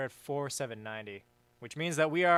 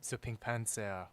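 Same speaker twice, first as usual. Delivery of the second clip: lower, softer mock French accent; 4 semitones lower, 7 dB quieter, slower than usual. Shown as a demonstration. Streamer improvising for a viewer, French accent. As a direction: put on a French accent, low and soft. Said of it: "Do a French accent".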